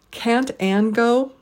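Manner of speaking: Can't, Anne, go each stressed slowly, go stretched up then down; slow three-beat Can't Anne go; deliberate stress on every word. Shown as a demonstration. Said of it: "stress three syllables in each phrase".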